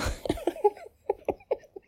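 bright high-pitched giggle. Real, not acted. Giggle in ASMR session.

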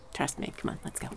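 ordinary talk, nothing marked

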